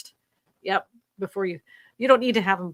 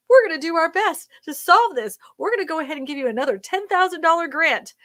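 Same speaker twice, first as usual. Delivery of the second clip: lightly voiced politician, higher and louder promise; mock the politician's empty pledge; 6 semitones higher, 4 dB louder than usual. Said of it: "all of them stand up there and say".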